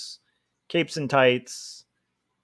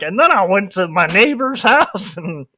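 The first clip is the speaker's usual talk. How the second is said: higher, breezy put-on voice with a laugh; mock casual killer; 4 semitones higher, 3 dB louder than usual. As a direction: confess a crime like it's nothing. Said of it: "one step away from being like".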